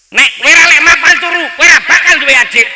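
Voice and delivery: put-on high, loud yell at the child; mock shout. Father yelling at his son to go to bed, loud high shout. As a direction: bellow it at the kid to scare him into bed.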